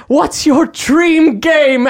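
lightly hammed faux Shakespearean; olde English accent. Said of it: "you dropped the voice and then got back into it".

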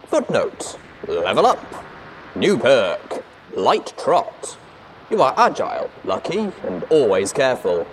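loud, high, emphatic announcer; game announcer. A narrator's reading. Narrator announcing a level up to listeners, game announcer. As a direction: announce the new perk loudly with punch.

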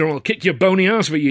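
high, indignant threat; mildly hammed-up fatherly anger; angry threat. A narrator's reading. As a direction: threaten him like a fuming father. Angry father threatens his son.

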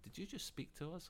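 a hushed creature voice. Retold or imitated. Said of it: "She's like".

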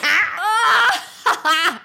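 high crying laughter; real tearful laughter; helpless laugh. Real, not acted. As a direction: break down laughing. Cracking up.

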